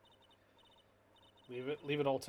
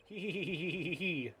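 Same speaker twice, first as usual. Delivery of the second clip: character giggle; 3 semitones higher than usual. Acted. Giggling game character line.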